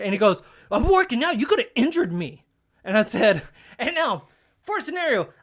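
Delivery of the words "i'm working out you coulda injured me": mock indignant complaint, raised pitch; heated. Gym guy scolding him for bumping in, mock heated. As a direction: imitate an aggrieved gym bro, louder and higher. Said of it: "He's getting heated at this point".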